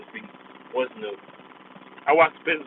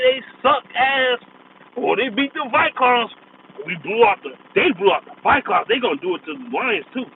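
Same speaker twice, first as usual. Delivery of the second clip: mocking fanboy commentator; 9 semitones higher than usual. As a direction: gush like a starstruck TV analyst, dragging each name out. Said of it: "that's how he was talking".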